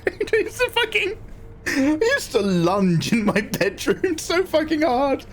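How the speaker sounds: excited squeal. Retold or imitated. Excited retelling of bedroom lunging.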